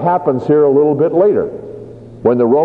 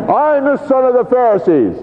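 proclaiming; 9 semitones higher than usual. Acted. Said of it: "Paul declaring to council".

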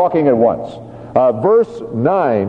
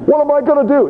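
anxious prayer; 12 semitones higher than usual. Acted. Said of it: "looking up to heaven and saying".

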